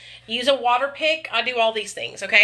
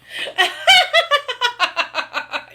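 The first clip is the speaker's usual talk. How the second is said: long high cackle, falling away; high cackling laugh; 14 semitones higher, 4 dB louder than usual. Real, not acted. Streamer cracking up at her chat, high cackle. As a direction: let the laugh burst out high.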